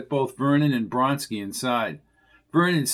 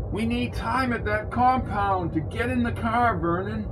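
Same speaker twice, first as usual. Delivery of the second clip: higher, friendly calling back; higher character voice; 8 semitones higher than usual. A narrator's reading. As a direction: call back to the trunk. Vernon voiced in story.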